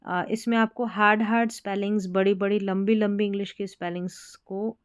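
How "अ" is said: normal talking voice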